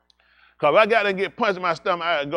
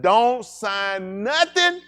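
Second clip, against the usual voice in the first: slow warning; 4 semitones higher, much slower than usual. Retold or imitated. Warning comrades not to sign.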